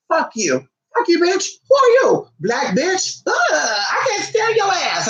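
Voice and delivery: hammed-up bratty kid yelling. Acting out a rude child.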